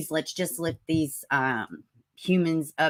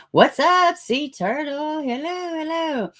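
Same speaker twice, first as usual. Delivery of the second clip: high sing-out hello, loud at first; high-pitched greeting; 11 semitones higher, 4 dB louder, slower than usual. Real, not acted. Streamer welcomes a viewer to the chat, high greeting.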